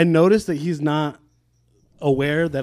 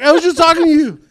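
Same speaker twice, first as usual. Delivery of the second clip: high, loud exasperated cry; can't believe he fell asleep; indignant disbelief; 13 semitones higher, 9 dB louder than usual. Retold or imitated. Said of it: "I was like".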